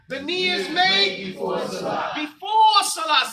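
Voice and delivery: slow, loud, emphatic, hitting "made" hard; with emphasis. Shown as a demonstration. Teacher drilling the class on the rule, loud slow emphasis on "made". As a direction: drill it out slowly and loudly, leaning on "made". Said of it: "Say it with the emphasis".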